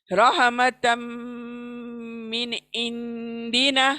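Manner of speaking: slow chant of रहमतम मिन इंदिना, मिन clear, इंदिना nasal; tajweed recitation. Shown as a demonstration. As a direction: recite slowly, clear नून then hidden नून. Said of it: "दूसरे इंदिना के नून को मैं छिपा रही हूँ नाक में".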